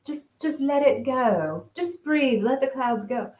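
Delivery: slow, soothing teacher imitation; mindfulness teacher voice. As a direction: lightly parody a calm meditation guide. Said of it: "in some mindfulness teachings".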